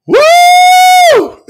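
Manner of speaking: loud high-pitched long "woo"; joyful whoop. Real, not acted. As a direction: whoop out in real elation. Overcome preacher whoops for joy.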